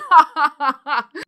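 loud, high-pitched burst of laughter; big cackling laugh. Real, not acted. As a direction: burst out laughing at your own remark. Laughing after her pregnancy disclaimer.

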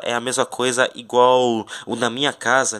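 ordinary talk, nothing marked

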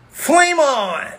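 very high, drawn-out cry; heroic battle cry. Acted. Fire hero answering his rock teammate, heroic battle cry. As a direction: call it out like a cartoon superhero igniting.

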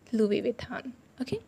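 Louis Vuitton whispered. Shown as a demonstration. Teacher whispers the brand name as a drill. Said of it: "repeats in a whisper".

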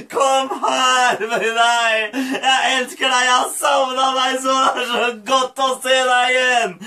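choked-up father's welcome; emotional loving father. Acted. Father embracing his lost son, emotional welcome. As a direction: welcome him, overcome with emotion.

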